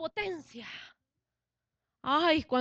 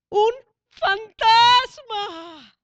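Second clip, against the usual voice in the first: drawn-out, louder, higher cry; frightened shout; 8 semitones higher, 12 dB louder, much slower than usual. Acted. Disciples in the boat crying out at a ghost, slow frightened shout. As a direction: stretch out fantasma in a terrified yell. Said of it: "Que gritaron".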